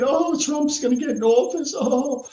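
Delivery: high, whiny mocking De Niro; mock De Niro crying. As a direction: whine it out like a blubbering actor. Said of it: "De Niro crying".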